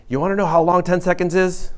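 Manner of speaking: voicing a smug student's challenge; student's quote. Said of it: "I had a student one time say".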